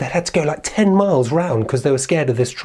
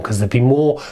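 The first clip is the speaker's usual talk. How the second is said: plain reading voice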